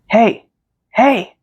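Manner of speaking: mild yell. A narrator's reading. Friend over the growing crowd, mild yell. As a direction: call out for attention, only half yelling. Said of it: "has to yell in your ear".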